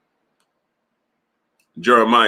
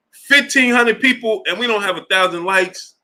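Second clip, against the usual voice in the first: exasperated outcry; 9 semitones higher than usual. Real, not acted. Complaining about too few likes.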